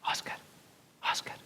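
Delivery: whispered calling of a name; soft whisper. Retold or imitated. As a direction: breathe the name softly. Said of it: "sentía el susurro". (susurro suave)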